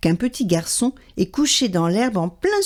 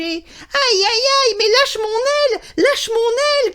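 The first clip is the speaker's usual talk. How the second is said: a bird falling from the sky; magpie crying out; 13 semitones higher than usual. A narrator's reading. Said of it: "crie la pie".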